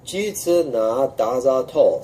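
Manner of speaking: slow, careful Jitsu Na Da Za To; teacher modelling the line slowly; slow syllable demo. Shown as a demonstration. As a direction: sound out each syllable. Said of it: "syllable by syllable".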